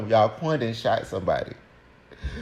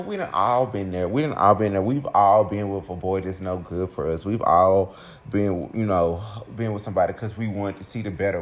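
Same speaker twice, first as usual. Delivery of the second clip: ordinary talk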